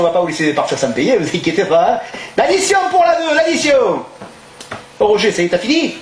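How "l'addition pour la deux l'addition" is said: calling out the bill. Acted. Restaurant owner calling to staff for the bill, raised voice. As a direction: call the bill across the room, higher. Calls order across restaurant.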